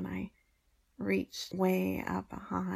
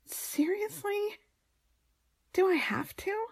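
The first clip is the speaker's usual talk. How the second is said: whiny protest; 12 semitones higher than usual. Acted. Protesting extra demand.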